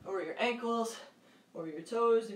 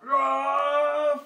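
loud higher roaring shout; giant lion roar; 4 semitones higher, 8 dB louder than usual. Shown as a demonstration. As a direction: roar big for the kids. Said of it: "Five big giant lion roars".

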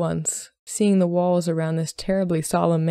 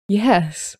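breathed; 3 semitones higher, 4 dB louder than usual. A narrator's reading. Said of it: "he breathed".

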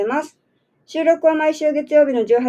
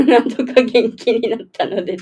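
笑いまじりに言い直す; 笑いをこぼしながら繰り返す; 笑いながら. Real, not acted. 言い直しながら笑う. (思わず笑いつつ言う)

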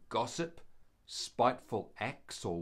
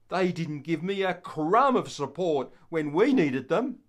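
higher, louder, scoffing complaint; resentful grumble; 4 semitones higher, 8 dB louder than usual. Acted. Peter refusing to waste time feeding the disciples, scoffing. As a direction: laugh it off, then sulk. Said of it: "I have a feeling Peter thought".

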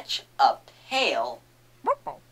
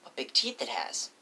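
whispering; 4 semitones lower, 6 dB quieter, faster than usual. Real, not acted. Whispered observation.

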